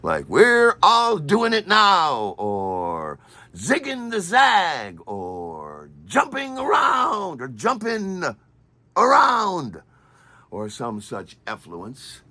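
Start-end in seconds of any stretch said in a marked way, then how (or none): 0.3-2.4 high, loud, drawn-out mock title; sing-song mockery of a TV title; stupid-sounding show title. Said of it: "stupid sounding television shows". 3.6-5.0 slow, stretched mock title; mocking TV-title announcement; stupid-sounding show title. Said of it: "with a stupid sounding name". 6.0-9.8 high, drawn-out mock announcer; slow, high mocking title call; stupid-sounding show title. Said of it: "with a stupid sounding name".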